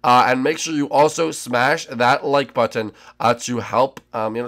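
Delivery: ordinary delivery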